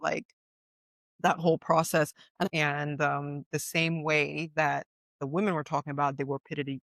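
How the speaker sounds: ordinary talk, nothing marked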